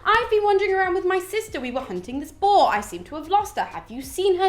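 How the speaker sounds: a goddess faking innocence; hammed-up chirpy Spartan girl; disguised huntress. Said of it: "she turns around and she's just like".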